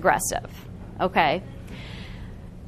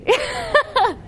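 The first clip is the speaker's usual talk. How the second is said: giggle. Real, not acted. Teasing the only man.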